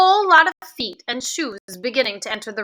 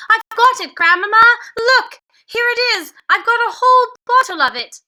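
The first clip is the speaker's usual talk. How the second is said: much higher, louder triumphant cry; excited cry; 10 semitones higher, 5 dB louder than usual. A narrator's reading. Boy bursting in to tell grandmother of success, excited. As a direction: burst in with the good news.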